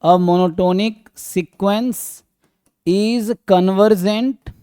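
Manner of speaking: drawn-out words, pausing between phrases; slow dictation. Real, not acted. Teacher writing out a theorem for students, slow dictation. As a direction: dictate it slowly for note-taking.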